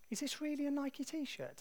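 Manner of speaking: high-pitched doubtful query; mock innocent question. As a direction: ask it high, feigning innocence. Acted question to the seller.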